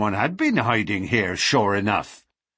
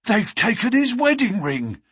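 gasped; 12 semitones higher, 4 dB louder than usual. A narrator's reading. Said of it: "he gasped".

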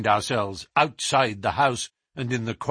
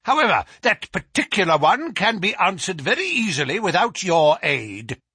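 tart, raised-voice dismissal; higher, louder, irritable professor; with asperity; 7 semitones higher, 5 dB louder than usual. A narrator's reading. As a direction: dismiss the visitor testily. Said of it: "said the Professor with asperity".

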